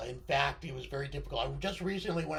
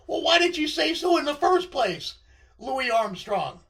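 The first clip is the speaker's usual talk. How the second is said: excited exclaim; 8 semitones higher, 8 dB louder than usual. Retold or imitated. Retold exclaim to friend.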